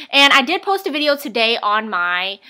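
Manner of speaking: usual speaking voice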